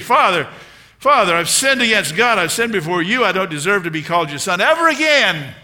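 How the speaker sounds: loud, high-pitched plea to a father; preacher acting the repentant son at full volume; son's confession speech. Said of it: "The son started his speech".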